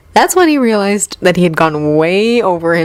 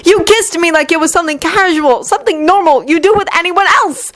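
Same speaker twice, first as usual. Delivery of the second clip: high, raised hurt voice; angry tirade; 11 semitones higher than usual. Acted. Yuri accuses Victor again and again, angry and undone. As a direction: repeat the charge, unraveling. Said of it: "Yuri snapped, finally becoming undone".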